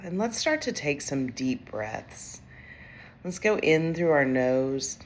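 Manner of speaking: calm, unhurried meditation voice with long pauses; guided meditation. Acted. Executive coach leads overwhelmed leaders through breathing, calm guided meditation. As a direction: guide them gently through each breath.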